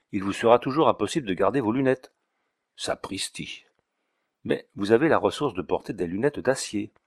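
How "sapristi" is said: breathy muttered sapristi under the breath; whispered oath. Acted. Notary curses under his breath, whispered. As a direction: hiss it out voicelessly like a private curse.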